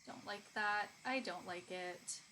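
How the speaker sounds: ordinary talk, nothing marked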